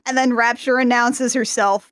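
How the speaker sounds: usual speaking voice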